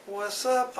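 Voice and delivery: high-pitched "what's up"; playful greeting. Acted. Host telling viewers to say hi, high playful greeting. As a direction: pitch it way up, like a catchphrase.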